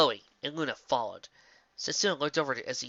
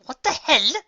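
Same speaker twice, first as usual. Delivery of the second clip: high, loud startled cry; shocked outburst; 10 dB louder, slower than usual. A narrator's reading. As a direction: blurt it out in shock. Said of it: "Her face screamed out".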